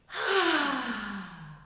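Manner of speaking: long audible sigh out; big sigh. Shown as a demonstration. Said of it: "give it a big sigh out".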